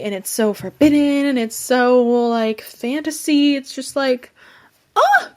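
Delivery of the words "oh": a very high, loud "oh!"; ecstatic squeal. Real, not acted. Reviewer gushing to viewers about the book, very high squeal. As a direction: squeal with delight. Overwhelmed by how good the book is.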